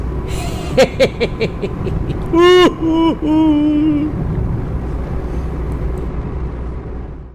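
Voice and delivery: long, high cackling laugh; big laugh. Real, not acted. Driver laughing at the car in front, long high cackle. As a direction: cracks up at his own joke.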